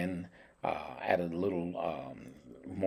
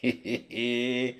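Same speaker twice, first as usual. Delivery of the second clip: higher hee-hee giggle; gleeful giggle; 4 semitones higher than usual. Real, not acted. Cook tickled by his own duck, gleeful giggle. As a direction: giggle with delight. Gloating over the dish.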